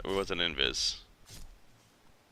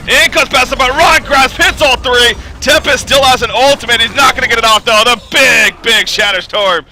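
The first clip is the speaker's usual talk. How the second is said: loud, fast-rising hype call; live play-by-play; 9 semitones higher, 7 dB louder than usual. Acted. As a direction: call the fight like an esports caster. Peak teamfight call, shouting.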